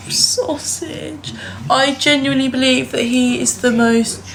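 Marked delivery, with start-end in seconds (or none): 0.1-1.3 whisper. Real, not acted. Soft words to the dog.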